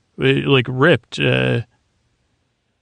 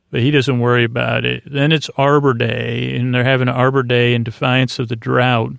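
normal talking voice

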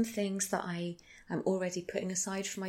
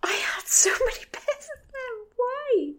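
high laughing cry of horror; half-crying, half-laughing whisper; mock horror wail; 12 semitones higher, slower than usual. Real, not acted. Horror at pedal pushers.

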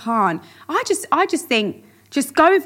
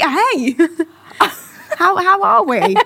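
higher, laughing cheesy pickup line; mock flirty pickup; 5 semitones higher than usual. Pretending to chat up a guy in his car, giggly mock flirt. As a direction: play the awkward flirt, giggling. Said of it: "you can sit in his passenger seat".

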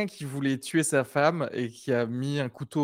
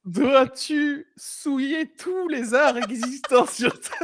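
mock dramatic; 11 semitones higher, 3 dB louder, much slower than usual. Mock-dramatic outrage at DJing.

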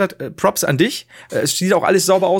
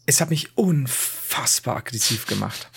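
whispered; 3 semitones lower, 5 dB quieter, slower than usual. Real, not acted. Confessing annoyance about ASMR.

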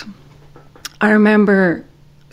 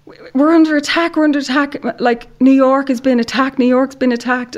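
high, urgent alarmed warning; frantic guard shouting the news; panicked security guard; 6 semitones higher than usual. Retold or imitated. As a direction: blurt it out in a panic, voice pitched up. Security guard yelling news of attack.

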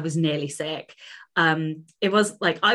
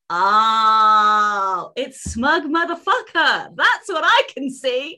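higher, louder, mocking dad impression; 6 semitones higher, 6 dB louder, much slower than usual. Dad teasing his daughter as a smug winner, hammed-up mockery. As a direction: lay on the teasing like a jeering father. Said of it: "And he was like".

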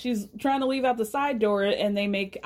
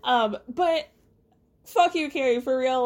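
ordinary talk, nothing marked

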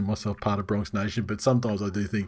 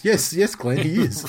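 higher, hammed-up insistent yes; mock insistence; 5 semitones higher than usual.

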